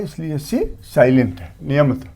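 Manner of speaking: usual speaking voice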